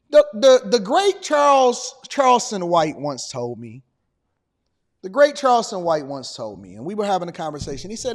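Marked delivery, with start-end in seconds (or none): none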